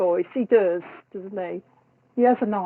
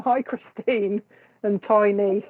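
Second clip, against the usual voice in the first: ordinary talk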